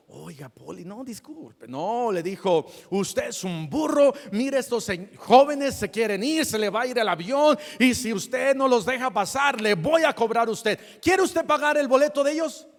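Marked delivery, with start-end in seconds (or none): 0.1-1.7 very soft, apologetic plea; mock-timid little request; meek, without authority. How the lady did not address the officer, meek and hushed. 2.9-12.7 loud, high, commanding telling-off; with authority. Retold or imitated. Airline lady ordering the officer to let passengers through, authoritative. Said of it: "le dijo con autoridad".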